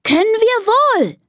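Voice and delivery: very high, defiant squeal; trumpeting elephant child. Acted. Elephant daughter contradicting the adults, high trumpeting. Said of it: "trompetet Karls Tochter Dina".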